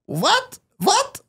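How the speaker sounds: put-on high, incredulous German-accented cry. Imagined Germans baffled by his prophecy, in a high comic German accent. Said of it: "your German accent is just Mel Brooks".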